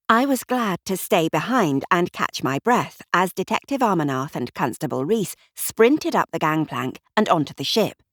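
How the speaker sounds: ordinary delivery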